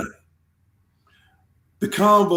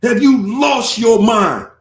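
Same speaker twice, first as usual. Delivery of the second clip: loud, higher, slow scolding yell; imagined angry scolding; 5 semitones higher, 5 dB louder than usual. Retold or imitated. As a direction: shake him awake with fury. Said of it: "yanked me up and said".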